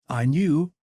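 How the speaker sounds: put-on high boyish voice, just waking; a small son, pleased and drowsy; little boy's voice. A narrator's reading. Son waking to his mother, high boyish voice. Sleepy boy voiced by narrator.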